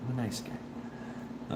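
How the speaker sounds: soft, slow, nearly whispered; quiet, drawn-out trailing repeat; hushed aside. Real, not acted. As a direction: let it fade into a murmur to yourself. Joking self-defence, then repeated softly.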